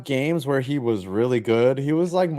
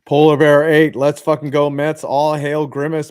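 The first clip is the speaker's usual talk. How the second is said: loud, higher rallying cry for the team; fan cheer; 5 semitones higher, 9 dB louder than usual. Real, not acted. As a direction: pump up the crowd, let's go Mets. Cheering on the Mets.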